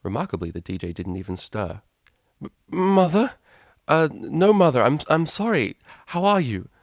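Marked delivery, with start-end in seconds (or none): 2.4-6.7 much higher, louder stammering panic; flustered. Acted. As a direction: caught off guard, scrambling to apologise. Flustered daughter caught out.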